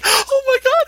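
very high, loud squealing women; gushing onlookers. Retold or imitated. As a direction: shriek it like excited diners. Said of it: "everybody around us is like".

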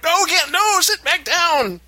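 high, loud frantic pleading; desperate squeal to keep her seated; husband begs wife to sit back down, high panicked cry; panicking. Retold or imitated. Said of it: "panicking at that point".

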